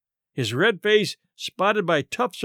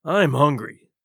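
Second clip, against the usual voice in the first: a low, hungry growl from the captain; 3 semitones lower than usual. A narrator's reading. Captain to Murray on the bench, growling. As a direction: growl it out, starving. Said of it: "growled the captain".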